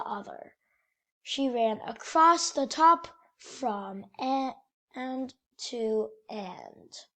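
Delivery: lively picture book storytelling voice; reading to children. A narrator's reading. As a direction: read it like bedtime story time for kids. Reads picture book aloud.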